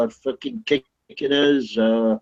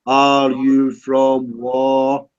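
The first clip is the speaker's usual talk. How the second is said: each word dragged out extremely long; exaggeratedly slow; much slower than usual. Real, not acted. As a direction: repeat it as if to someone hard of hearing, very slowly. Repeats slowly for someone not hearing.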